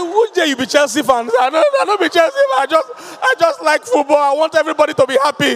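high, loud teasing dialogue; mock football banter. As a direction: play the defensive football fan. Acted banter about Chelsea fan.

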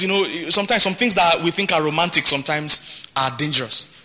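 ordinary delivery

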